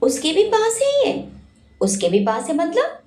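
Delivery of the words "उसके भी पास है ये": higher pitch, है rising in disbelief; stunned surprise. A narrator's reading. Virat learns Shanaya has the photo, stunned. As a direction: gasp out the question, shocked. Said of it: "Virat हैरानी से बोला".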